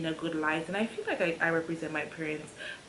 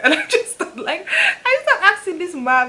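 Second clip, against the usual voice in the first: much higher, louder, laughing through words; can barely get the words out laughing; 11 semitones higher, 10 dB louder than usual. Real, not acted. Telling viewers the story, laughing hard. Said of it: "started laughing".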